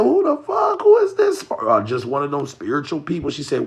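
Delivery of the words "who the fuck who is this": much higher and louder, squealing disbelief; incredulous squeal. Retold or imitated. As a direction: shriek at the stranger in shock. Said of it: "I said".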